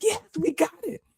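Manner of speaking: very high, squeezed cheer; small high-pitched cry of victory; excited middle mind. Retold or imitated. Middle mind seeing it all, high excited cheer. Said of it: "in my middle mind and I'm like".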